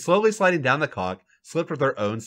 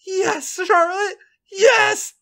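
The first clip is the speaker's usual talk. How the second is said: high, loud, drawn-out groan; groaning cry; 4 dB louder, much slower than usual. A narrator's reading. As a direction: cry it out in pleasure. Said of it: "Becky groans out".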